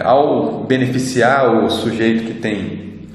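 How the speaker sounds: ordinary talk, nothing marked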